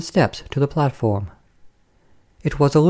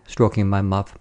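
usual speaking voice

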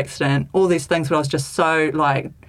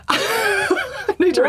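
laughing hard through the words, much higher; much slower than usual. Real, not acted. Recalling calling an ambulance, laughing hard. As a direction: giggle it out, slowly. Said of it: "I need to ring the ambulance".

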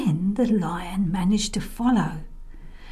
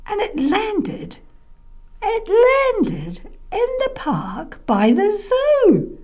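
high, drawn-out landing; 13 semitones higher, 4 dB louder, slower than usual. A narrator's reading.

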